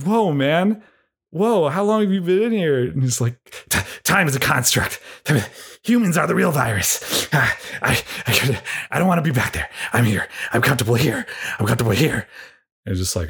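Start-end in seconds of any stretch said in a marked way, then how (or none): 0.0-2.8 surprised rescuer. Retold or imitated. Rescuer finding the trapped man. 3.7-12.3 spaced-out hermit. Retold or imitated. Man changed after three thousand years.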